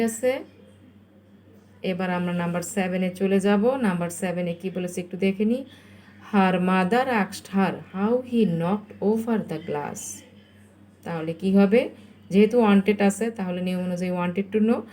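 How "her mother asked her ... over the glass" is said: stretched, deliberate question sentence; slow dictation. Shown as a demonstration. Teacher reads the next exercise to pupils, very slowly. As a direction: read it out very slowly.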